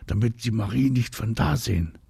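plain reading voice

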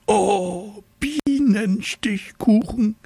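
high squeal of longing; very high, breathy squeak of delight; squeaky delight. A narrator's reading. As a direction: squeak it out, dreamy and slow. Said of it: "quietschte der kleine Tiger".

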